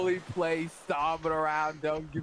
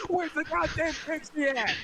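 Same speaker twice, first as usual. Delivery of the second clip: high-pitched acted interrogation; raging in-character confrontation; angry demand; 12 semitones higher than usual. Acted angry grab by the collar.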